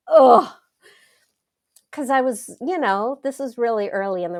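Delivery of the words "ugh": loud reluctant groan of "ugh"; sharp loud disgusted ugh. Retold or imitated. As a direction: groan it out in dread. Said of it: "And I'm like".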